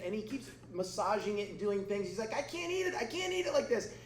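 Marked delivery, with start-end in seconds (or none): 2.3-3.9 high-pitched mock whine; whining child. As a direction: play the fussy kid, pitched right up. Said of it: "he starts whining and literally crying".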